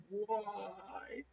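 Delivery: soft, high, drawn-out mock whine; imitated crying wail. Two drunk girls crying during the argument, mock wail. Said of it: "the drunk girls start crying again".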